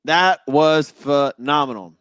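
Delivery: "That was phenomenal" stretched out slowly, every word drawn long; slow down and savor "phenomenal"; slow savoring repeat. Real, not acted. Fan relishing the hockey fights to his audience, slowly savoring.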